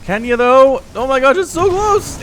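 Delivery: high, loud burst of real suspense; sportscaster yelling at a nail-biting moment; excited commentary shout. Real, not acted. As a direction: cry out as the play hangs by a thread. Live commentary, a very close moment.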